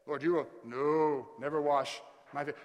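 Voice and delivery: loud, insistent protest; emphatic refusal. Acted. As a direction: refuse as Peter, punching the no. Acting Peter's refusal.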